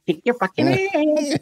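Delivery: high comic Pee Wee delivery; catchphrase voice. Shown as a demonstration. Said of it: "And I said it like that".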